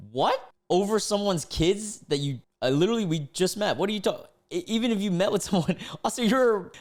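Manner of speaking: baffled and indignant, pitch up; incredulous objection. Real, not acted. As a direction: protest in a high, baffled rush.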